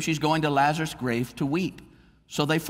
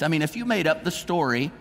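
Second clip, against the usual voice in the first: ordinary delivery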